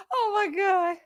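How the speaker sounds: high, wailing through laughter; cry-laugh it out. Real, not acted. Helpless laughter.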